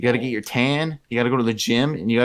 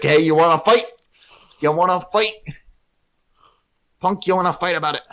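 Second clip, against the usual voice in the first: higher, louder mock bar-brawl challenge; Mario wanting a fight; 8 semitones higher, 4 dB louder than usual. Imagining Mario in a bar fight, hammed-up tough-guy dare. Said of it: "and he's like".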